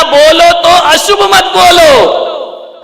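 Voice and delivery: very high, loud preaching cry, last words stretched; shouted proclamation. Real, not acted. Preacher quoting Mahavir's teaching to the congregation, shouted proclamation. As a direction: proclaim it to the whole hall.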